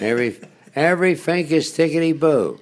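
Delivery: put-on playful Baltimore accent bit for the interviewer. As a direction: toss it off in a mock Baltimore local voice. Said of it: "You had the accent down".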